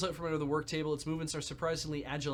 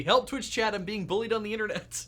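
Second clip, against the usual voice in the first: high, loud mock cry for rescue from chat; playful fake distress call to the viewers; mock plea for help; 6 semitones higher, 7 dB louder than usual. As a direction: ham up a bullied victim begging chat. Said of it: "It would be".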